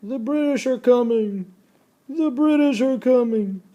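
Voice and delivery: high, urgent cry of warning; Paul Revere's call. Retold or imitated. Teacher acting out Paul Revere's warning, high urgent call. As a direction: call it out like a rider raising the alarm. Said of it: "the good old story of Paul Revere".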